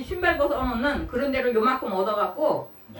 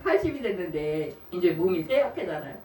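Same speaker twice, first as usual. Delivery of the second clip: normal talking voice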